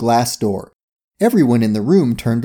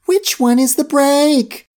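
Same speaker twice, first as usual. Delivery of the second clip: high, drawn-out panicked scream; screamed question; 13 semitones higher, slower than usual. A narrator's reading. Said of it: "Vicky screamed".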